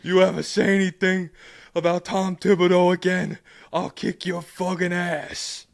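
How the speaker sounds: gasping fan threatening, slowing at the end; out-of-breath mafia threat. Retold or imitated. Podcaster imitating a breathless fan making a threat, loud. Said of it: "They're like".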